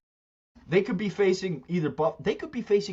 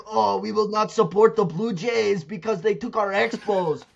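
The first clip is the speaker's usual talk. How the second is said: higher, whiny imitation of complaining fans; put-on crybaby complaint; mock crybaby fans; 5 semitones higher, 4 dB louder than usual. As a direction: mimic sore losers' protest, hammed up. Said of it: "they're still crying about the Expos".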